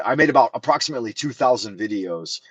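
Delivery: ordinary talk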